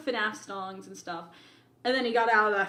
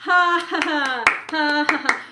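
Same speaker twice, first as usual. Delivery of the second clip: big real belly laugh, loud and high; loud genuine ha ha ha; loud laughter; 5 semitones higher, 9 dB louder than usual. Real, not acted. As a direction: burst out laughing at the joke.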